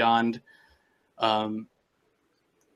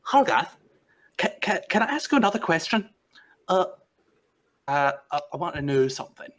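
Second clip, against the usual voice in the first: eager Nyctis; 6 semitones higher, faster than usual. Acted. Nyctis pestering Hargath.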